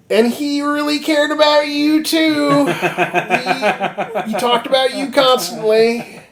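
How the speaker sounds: high falsetto mock sincerity, laughing; sarcastic falsetto breaking into laughs. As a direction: squeak it out sarcastically through laughter. Guts and Casca mock the princess.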